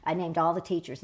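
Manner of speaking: ordinary delivery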